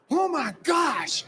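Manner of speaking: high, drawn-out gasp of shock; slow astonished oh my gosh; stunned gasp. Retold or imitated. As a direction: let it slip out slowly in disbelief. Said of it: "Jerry turned around and went".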